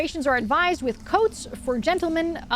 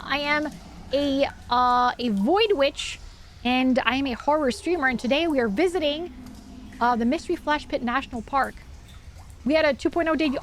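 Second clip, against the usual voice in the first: ordinary talk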